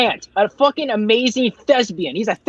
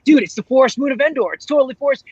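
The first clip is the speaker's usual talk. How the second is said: a touch of eager insistence, pitched up; excited guess; 5 semitones higher, faster than usual. Retold or imitated. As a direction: retell an excited fan's guess, higher. Acts out excitedly guessing the planet.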